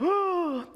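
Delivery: high-pitched imitated gasp; mock little-girl gasp; shocked gasp. Little girl rebuffed by stranger, imitated shocked gasp. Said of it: "the little girl was like".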